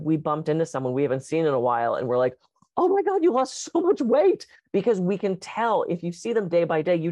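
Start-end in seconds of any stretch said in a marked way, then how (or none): 2.8-4.5 high, mock-astonished exclamation; surprised. Meeting someone who lost weight, mock amazed high exclamation. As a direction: gush in imitated surprise, pitched up. Bumping into someone after a while.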